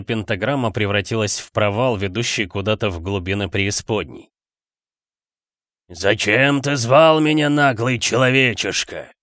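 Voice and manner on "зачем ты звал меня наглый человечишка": booming grating demon voice; slow, thunderous and grinding; громовой голос. Acted. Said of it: "проскрежетал громовой голос". (громовой скрежещущий голос демона)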